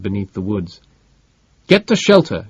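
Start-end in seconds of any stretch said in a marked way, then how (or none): 1.7-2.5 loud, high yell of warning. A narrator's reading. Sherrinford orders Barbro to shelter, loud high yell. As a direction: bellow the order across the meadow. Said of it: "Sherrinford yelled".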